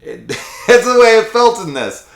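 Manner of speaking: high, loud laughing speech; real laughter breaking through the words. Real, not acted. Reviewer amused by the absurd act, laughing.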